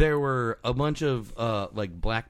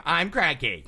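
higher, grumpy declaration; cranky proclamation; 4 semitones higher than usual. Retold or imitated.

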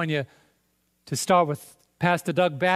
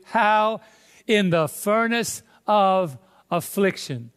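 slow, raised scripture delivery with pauses; emphatic preaching; 2 semitones higher, 3 dB louder, slower than usual. Acted. Preacher quoting Isaiah to the congregation, emphatic and drawn out. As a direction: stretch and punch each key word of the verse.

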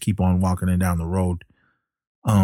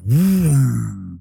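mouthed engine roar, louder onset, falling away; car vroom; 4 semitones higher, 4 dB louder than usual. Retold or imitated. Narrator imitates the car pulling up, engine vroom.

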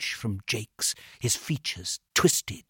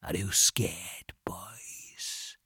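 slow, menacing whisper; soft, drawn-out taunt; very quiet; 4 semitones lower, 8 dB quieter, much slower than usual. A narrator's reading. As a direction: tease them softly and slowly in a whisper. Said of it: "he said very quietly".

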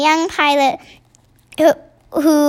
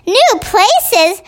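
higher, louder incredulous shout; 6 semitones higher, 3 dB louder than usual. A narrator's reading. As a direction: bark the question back in disbelief. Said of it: "shouted the head".

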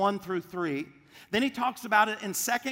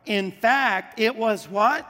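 louder, pushing the crowd to answer; loud call-out; 3 semitones higher, 8 dB louder, slower than usual. Real, not acted. Pastor prompting the congregation mid-verse, loud call-out. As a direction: build up and demand the answer.

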